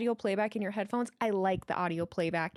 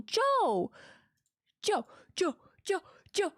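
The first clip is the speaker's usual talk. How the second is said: excited; 9 semitones higher, slower than usual. Real, not acted. Calling out donor's name repeatedly.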